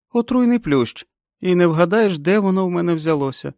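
високий гучний жіночий персонаж; озвуч пацієнтку значно вище й голосніше; голос Кенді. A narrator's reading. Кенді скаржиться Френ на висип. (високий голос героїні)

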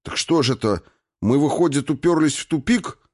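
rising, agitated challenge; exasperated. A narrator's reading. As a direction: demand an answer, climbing in pitch. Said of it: "Аратарн сжал кулаки". (с нарастающим возмущением)

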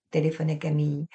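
usual speaking voice

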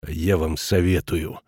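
low, slow warning; grim menace. A narrator's reading. Kraner warning the headmaster off, grim. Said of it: "мрачно сверкнув глазами".

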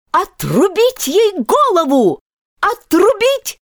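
high-pitched, furious queen's shriek; enraged scream. A narrator's reading. Queen raging at the girl who defied her, enraged scream. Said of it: "завопила во весь голос".

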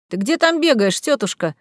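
usual speaking voice

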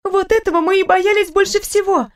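a fussing nurse who cannot stop talking; chatty nurse. Acted.

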